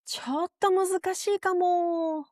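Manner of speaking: ためらいがちな高めの声で断る; polite refusal. Shown as a demonstration. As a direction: say it like a gentle apologetic no. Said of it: "「ちょっと難しいかも」と言われたら".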